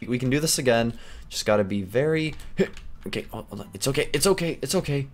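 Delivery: ordinary talk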